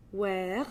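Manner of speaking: "Where" drawn out with a French R. Shown as a demonstration. Pointing out that R, French "Where". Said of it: "notice that R".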